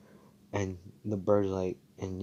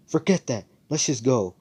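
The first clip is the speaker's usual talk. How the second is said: eager quote; 5 semitones higher, 5 dB louder than usual. Retold or imitated. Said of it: "he's like".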